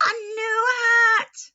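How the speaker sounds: excited gift. Acted. Niece giving her uncle a new hat, thrilled. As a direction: announce the present with joy.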